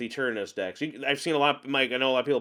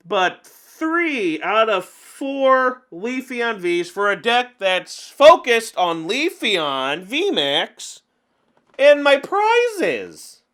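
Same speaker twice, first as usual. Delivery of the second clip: exasperated rant; 5 semitones higher, 5 dB louder, much slower than usual. Real, not acted. Ranting about prized Leafeons.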